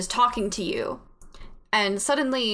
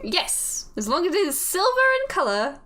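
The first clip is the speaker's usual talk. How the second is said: lilting put-on British, swooping up and down; bad British accent; 3 semitones higher than usual. Voice in the head assigns the player a simple errand, lilting British accent. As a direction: coax them in hammy British. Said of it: "My horrible British accent".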